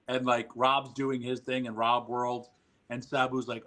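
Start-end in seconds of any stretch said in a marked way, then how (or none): none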